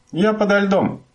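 high call from under the ice; shout. A narrator's reading. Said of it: "закричала Туутикки".

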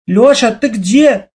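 high, slow call across the hall; mother calling out. A narrator's reading. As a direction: shout for a lost son. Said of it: "закричала мама". (мама громко зовёт сына)

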